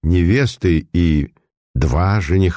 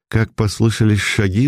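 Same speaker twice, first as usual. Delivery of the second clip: ordinary delivery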